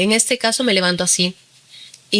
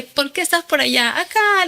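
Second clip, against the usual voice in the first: lifted, higher-pitched quoted exchange; retold phone chat; 8 semitones higher than usual. Cousin asks why she is there, lightly acted high-pitched chat. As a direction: lightly act out both sides, pitched up.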